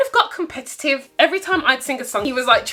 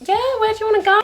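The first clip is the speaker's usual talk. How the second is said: put-on girly reply; 10 semitones higher, much faster than usual. Voicing herself on the date.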